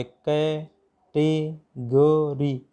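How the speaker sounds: "Category" very slowly in four separate syllables. Shown as a demonstration. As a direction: break "category" into कै, टे, ग, री with pauses. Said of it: "category में चार हो गए". ("कैटेगरी" के चार अक्षर रुक-रुक कर, बहुत धीरे)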